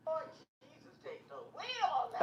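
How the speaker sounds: like the stream replaying it from far off; faint far echo. Real, not acted. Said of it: "let me turn this off".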